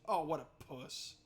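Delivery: usual speaking voice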